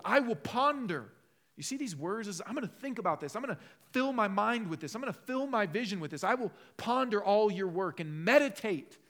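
loud, high, impassioned preaching; fervent preaching. Acted. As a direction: press the point home to the congregation. Preaching the psalm.

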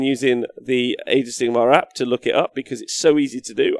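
ordinary talk, nothing marked

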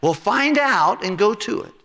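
laughing urging voice. Retold or imitated. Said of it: "And he said".

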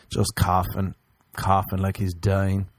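ordinary talk

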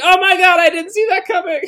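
very high squealing surprise; shocked reader. Retold or imitated. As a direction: squeal like a stunned fan. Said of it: "they're like".

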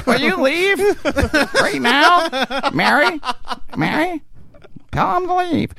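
a panicked falsetto begging him off; squeaky nervous plea. Retold or imitated. Mocking his own scared voice pleading with Mary. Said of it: "Like Abraham Lincoln".

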